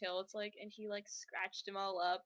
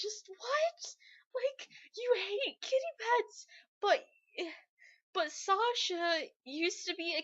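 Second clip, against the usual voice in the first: incredulous; 11 semitones higher, 4 dB louder than usual. Real, not acted. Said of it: "her own disbelief".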